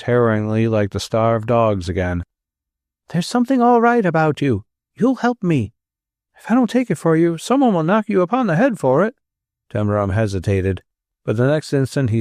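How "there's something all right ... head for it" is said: pleading stranger. A narrator's reading.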